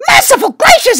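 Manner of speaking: ordinary delivery, nothing marked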